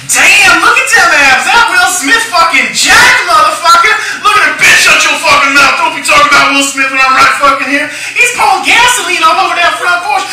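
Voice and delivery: high, loud, yelling impression of the woman in the theater; loud sassy moviegoer. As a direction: shout at the screen like a rowdy audience member. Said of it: "this is verbatim how some of the conversation went".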